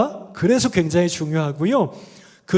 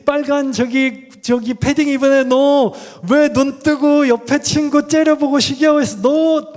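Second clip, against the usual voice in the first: higher, louder mock scolding of a child; imitated scolding Jesus; 6 semitones higher, 4 dB louder than usual. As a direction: call out the kid in the red padding like a stern teacher. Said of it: "여러분, 예수님이". (빨간 패딩 아이를 꾸짖는 예수님 흉내, 높고 큰 목소리로)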